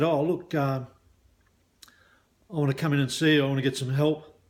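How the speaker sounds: ordinary talk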